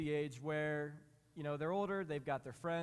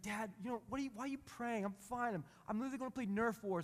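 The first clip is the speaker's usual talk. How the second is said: higher, lightly voiced embarrassed kid; imitating his son; 7 semitones higher than usual. Son in the car protesting his father's prayer, mock kid voice. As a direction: play the son brushing off dad's prayer. He's like.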